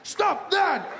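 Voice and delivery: high frantic shouts; high panicked yelling. Pretend. America panics at a bucket, high yelling. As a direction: freak out at the bucket.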